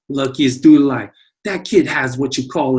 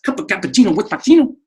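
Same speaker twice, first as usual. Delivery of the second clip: fast tongue twister; 7 semitones higher, 4 dB louder, much faster than usual. Shown as a demonstration. Teacher challenges himself with a tongue twister, fast. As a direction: rattle it off fast. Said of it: "Say that a couple times fast".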